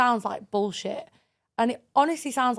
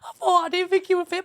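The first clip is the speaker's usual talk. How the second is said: usual speaking voice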